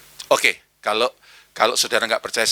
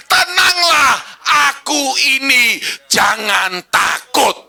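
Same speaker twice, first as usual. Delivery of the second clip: high, loud and slow group recital; shouted in unison; 12 semitones higher, 7 dB louder, slower than usual. Shown as a demonstration. Preacher leads congregation reciting, shouting it slowly. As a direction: shout the verse together, slowly. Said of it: "Satu, dua, tiga".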